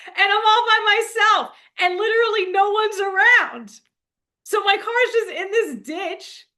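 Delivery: can't stop laughing at the mess she's in; giggling while telling how the car sat in the ditch. Real, not acted. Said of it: "I'm laughing".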